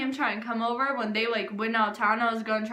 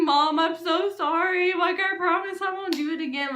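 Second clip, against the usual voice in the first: higher, whiny imitation of begging mom; mock tearful pleading; 5 semitones higher than usual. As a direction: plead as if close to tears. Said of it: "I'm crying all day".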